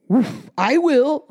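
loud, slow woofing dog; eager dog voice. Acted. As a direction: bark it eagerly. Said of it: "said the dog".

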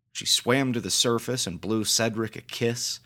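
ordinary delivery

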